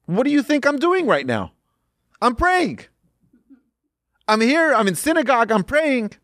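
high-pitched mock exasperation; incredulous protest. Worshipper puzzled why he asks to pray while praying, incredulous. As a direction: act out a baffled worshipper protesting, voice held high.

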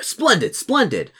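high-pitched hearty approval; delighted paladin. Acted. Paladin pleased to see reinforcements arrive, delighted. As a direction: exclaim it with eager relish.

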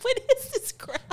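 high, loud, drawn-out mock outrage; indignant quote. Retelling a visit to the broken site, mock indignant. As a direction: stretch it out in exaggerated disgust.

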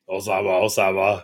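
low-pitched creature saying its name; deep, playful Pokémon voice; Pokémon name call. Pretend. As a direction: drop low and repeat like a Pokémon. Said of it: "what would you sound like as a Pokemon".

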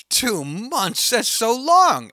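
mock exasperated protest, pitched high; exaggerated dismay at the wait; Tom Hanks complaining.

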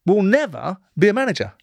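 high, emphatic retold verdict; telling Tony Adams he'll never manage, pitched high. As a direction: hammer home the no with rising pitch. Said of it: "I said".

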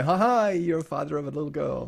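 hammed-up congratulations, high and loud; mock jubilant announcement. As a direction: crow the big news like a proud announcer. Acting out a joyful birth announcement.

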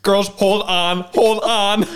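loud, high rallying cry; mock urging. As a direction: call out to the girls to hang on. Mock urging women to survive.

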